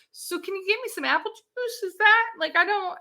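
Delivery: high-pitched, lightly voiced groggy pleading; small high voice, mildly out of it; loopy patient. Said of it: "loopy from what's going on".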